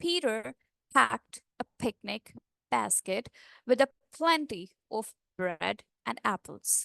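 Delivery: slow, soft, each p and b popped gently; gentle plosives. Shown as a demonstration. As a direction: say "Peter packed a picnic basket" softly and slowly, popping the p's. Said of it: "बहुत आराम से, प्यार से".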